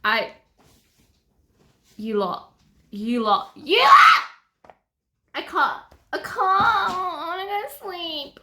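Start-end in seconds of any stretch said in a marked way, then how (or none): 2.9-4.2 overwhelmed shout. Real, not acted. Overwhelmed by the book. 6.4-8.4 slow, high whimpering wail; mock crying whine. Real, not acted. Overwhelmed, whining.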